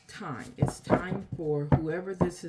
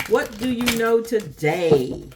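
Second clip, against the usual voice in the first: higher, louder, slow call-out; a raised, slow question to the viewers; 7 semitones higher, 5 dB louder than usual. Shown as a demonstration. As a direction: ask it out loud, drawn out. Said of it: "said twice, second hushed".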